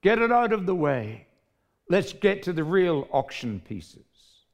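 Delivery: impatient crowd yelling at the auctioneer. Acted. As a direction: shout it out, fed up. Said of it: "The crowd yelled out".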